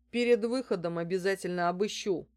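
lightly voiced stern guard; warning guard. A narrator's reading. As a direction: give a firm procedural warning. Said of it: "предупредил старший из охранников". (предупреждая, строго)

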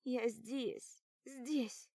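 mumbled through taped lips; mouth taped shut. Acted. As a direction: moan words with mouth sealed. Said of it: "Мычу с заклеенным ртом".

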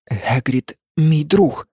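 slow, halting, understated tremble; trembling voice. A narrator's reading. Harry defending his friend Hagrid to Riddle, mildly trembling voice. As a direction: say it slowly, holding back emotion. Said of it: "сказав тремтячим голосом Гаррі".

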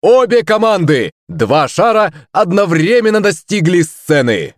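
high-pitched stage announcement; triumphant showman shouting out the winners; excited host's cry. A narrator's reading. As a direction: proclaim the result to the whole hall. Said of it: "закричал ведущий".

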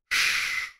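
hushed, voiceless "shh"; soft shush. A narrator's reading. Girl answering Laurel while she listens at the window, soft shush. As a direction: shush softly, without any voice. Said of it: "відгукнулася дівчинка, не обертаючись".

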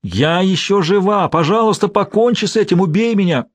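rising tortured cry; anguished screams. A narrator's reading. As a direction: plead to be killed in agony. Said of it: "крики звучали всё громче".

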